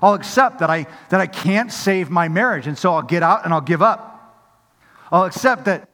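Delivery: impassioned preacher's push; full-force preaching. Acted. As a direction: build the fervour line by line.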